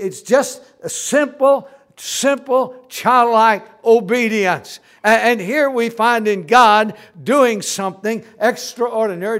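loud, emphatic pulpit delivery; forceful sermon voice; full-force preaching. Real, not acted. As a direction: preach it loud, leaning on the big words.

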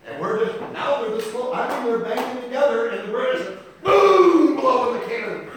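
a full-throated preacher's build; preaching at full force. Acted. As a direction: thunder it out from the pulpit.

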